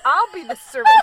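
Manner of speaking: whiny, high and loud, laughing; sulky martyr's whine, loud. Retold or imitated. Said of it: "he was doing a whiny voice".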